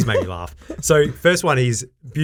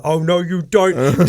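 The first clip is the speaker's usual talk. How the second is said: mock menace; 5 semitones higher, 6 dB louder, slower than usual. Voicing Danny DeVito meme.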